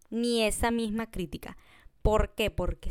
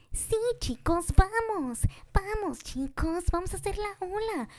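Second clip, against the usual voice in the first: much higher, cheery forced enthusiasm; peppy group leader; 10 semitones higher than usual. Retold or imitated. Mocking an overly positive group leader, high and peppy. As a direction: hype the group like a camp counselor. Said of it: "en un tono así como que de profesora".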